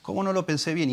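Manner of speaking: high, whiny mock lament of a clumsy thief; self-reproaching thief. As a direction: whine like a thief kicking himself for a botched job. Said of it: "Y está diciendo".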